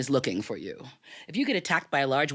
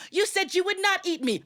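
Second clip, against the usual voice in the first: panicked protest; 14 semitones higher than usual. Acted. Brahmin pleading under tiger's paw.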